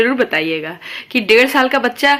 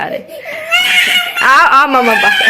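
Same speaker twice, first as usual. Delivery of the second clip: slow, raised call, arms open; coaxing call; 3 dB louder, much slower than usual. Real, not acted. Mother calls her sulking toddler over, loud and slow.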